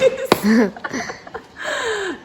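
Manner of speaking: high real laughter. Real, not acted. Teacher laughs at the silent toy hammer, laughing. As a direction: burst out laughing.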